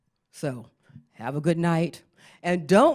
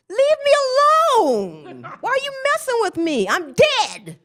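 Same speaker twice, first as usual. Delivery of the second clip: shrill high yelling ghost, fast and loud; cranky dead woman screaming to be left alone; angry ghost; 7 dB louder than usual. Retold or imitated. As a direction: shriek at the living like an outraged ghost.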